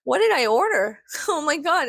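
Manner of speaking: half-laughing what did I do; groggy alarm. Retold or imitated. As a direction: act out waking to a surprise order. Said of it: "I'm like".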